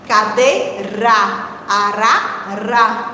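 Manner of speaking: "cadeira" and "Arara" low, slow, final "ra" set apart; syllable by syllable. Shown as a demonstration. Teacher compares last syllables for children, sounded out. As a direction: split off the last "ra" slowly. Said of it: "analisar só a última sílaba".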